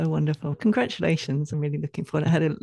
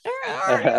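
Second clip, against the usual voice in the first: high-pitched playful dog "arr"; imitated happy dog noise; 4 dB louder, slower than usual. Host showing guest how their happy dog sounds, high mimicked yowl. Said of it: "he makes noises like a human".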